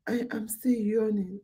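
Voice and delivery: whispered and sleepy, mid-yawn. Real, not acted. Tired host whispers to viewers that she's yawning. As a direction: breathe it out through a yawn. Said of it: "look, I'm still yawning".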